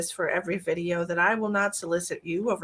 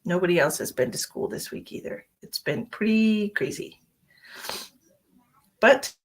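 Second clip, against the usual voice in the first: ordinary delivery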